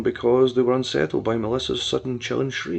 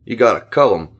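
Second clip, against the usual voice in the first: lower, cold and callous; lightly voiced callous villain; 4 semitones lower, 5 dB louder than usual. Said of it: "a cold, callous laugh".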